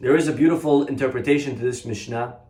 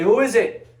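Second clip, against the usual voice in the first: higher, louder call through the door; 9 semitones higher, 5 dB louder than usual. Retold or imitated. Boy calling out to the knocker, louder. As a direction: shout it at the knock.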